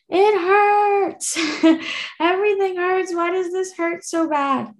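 high, pained whine; whimpering like a hurting child; high whiny voice. Retold or imitated. Woken after surgery, whining to the nurses in pain.

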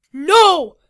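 full, high-pitched yell; yelled refusal. A narrator's reading. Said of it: "Vito yelled".

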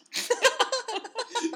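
squealing laugh; losing it while reading aloud; high burst of laughter. Real, not acted. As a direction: break into high-pitched giggles. Cracks up reading the fanfic.

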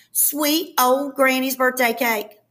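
ordinary delivery, nothing marked

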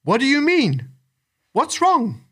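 high, worried bird voice; anxious vulture. A narrator's reading. Vulture asks his laughing friend what happened, anxious. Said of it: "asked the vulture anxiously".

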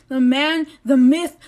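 usual speaking voice

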